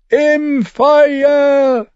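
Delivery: very high, loud, drawn-out FEUER; shrill prophetic scream. A narrator's reading. Prophet threatening sinners with fire, shrill scream on "Feuer". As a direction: shriek "Feuer" as the climax of doom.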